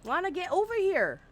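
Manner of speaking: high-pitched playful summons; calling out. Pretend. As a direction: call her over, pitched well up. Calls out to game character.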